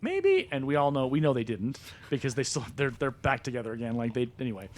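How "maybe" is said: high, teasing maybe; coy high "maybe". Retold or imitated. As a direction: squeak it out coyly. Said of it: "And she's like".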